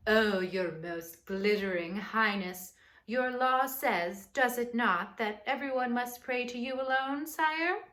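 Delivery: butter up the king with a sly grovel; fawning courtiers. Acted. Sneaky helpers flattering the king to trap Daniel.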